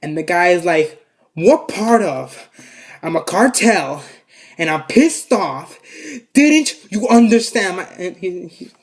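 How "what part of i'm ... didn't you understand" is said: slow, emphatic acted anger, rising louder at the end; drawn-out mock fury, building to a raised finish; pissed-off gunman. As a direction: spell it out word by word, fed up. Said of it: "he was so pissed at my grandma".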